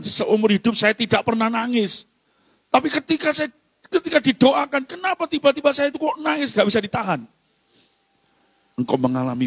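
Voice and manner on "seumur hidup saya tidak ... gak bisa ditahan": higher-pitched quoted testimony; imitating an amazed man. A man testifying he cannot hold back tears, lightly imitated. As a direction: lightly act out a man baffled by himself. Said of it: "bapak mengatakan".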